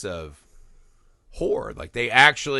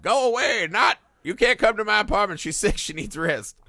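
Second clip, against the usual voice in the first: mock old lady; 8 semitones higher than usual. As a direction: shoo them away like a fussy old woman. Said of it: "the old lady's like".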